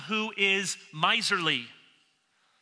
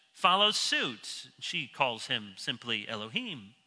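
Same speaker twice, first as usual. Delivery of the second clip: usual speaking voice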